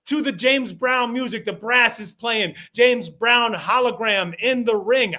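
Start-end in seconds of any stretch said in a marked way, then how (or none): none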